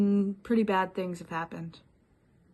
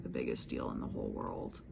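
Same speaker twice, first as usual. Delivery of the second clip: much softer and lower, letting the air out; quiet deflating; 10 dB quieter than usual. Shown as a demonstration. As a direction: drop it, it is no big deal after all. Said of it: "in fact".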